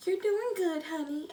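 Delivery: high, soft sweet-husband voice; sweet reassurance. Retold or imitated. Husband checking on his new wife, sweet. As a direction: coo it softly and encouragingly. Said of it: "so sweet".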